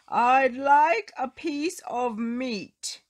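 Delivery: slow, loud booming giant voice; loud booming demand. Acted. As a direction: boom it out, big and slow. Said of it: "he boomed".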